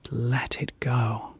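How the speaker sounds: usual speaking voice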